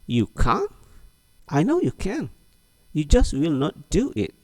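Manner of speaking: high, teasing disbelief; don't let the friend off the hook; high, teasing, disbelieving reply; doubting Lina. Acted. Lina replies in the dialog.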